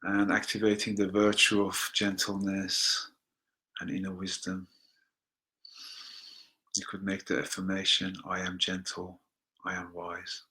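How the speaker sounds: very soft, low meditation voice; soft affirmation. Acted. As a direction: let the words sink in quietly.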